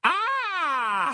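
a very high, sudden "Ah!" of discovery; high theatrical cry. A narrator's reading. Trelawney startled to find Harry at the door, high cry. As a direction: cry out in mock triumph. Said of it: "s'écria-t-elle".

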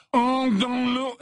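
slow, high, drawn-out curse; hate-filled exclamation. A narrator's reading. Hateful boy casting a curse at his rival, exclaiming.

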